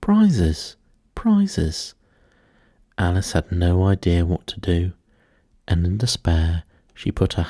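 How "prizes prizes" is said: high, eager cries of prizes; high excited chant of prizes; excited calling out. Acted. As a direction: call it out high like a clamouring crowd. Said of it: "calling out in a confused way".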